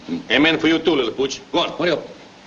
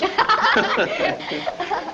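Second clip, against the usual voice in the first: laugh; 12 semitones higher than usual. Acted. Laughing at dog's tricks.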